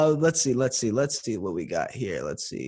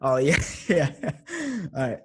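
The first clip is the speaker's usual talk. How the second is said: usual speaking voice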